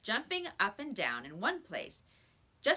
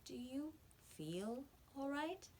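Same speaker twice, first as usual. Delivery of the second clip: soft, slow, higher worried teacher; slow, concerned teacher; 6 semitones higher, 10 dB quieter, slower than usual. A narrator's reading. Teacher asks the boy if he feels well, slow and soft. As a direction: ask gently, slowly. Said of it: "said Miss Plimsoll slowly".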